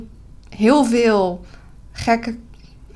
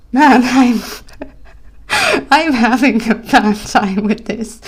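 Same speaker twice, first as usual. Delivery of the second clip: loud flustered laughter through the words; laughing at herself; 2 semitones higher, 4 dB louder than usual. Real, not acted. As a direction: laugh it off, tired and struggling. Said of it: "now you can tell I'm tired".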